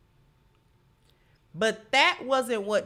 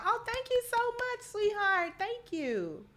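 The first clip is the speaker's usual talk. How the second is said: excited thanks; 12 semitones higher than usual. Real, not acted. Thanking a Patreon supporter.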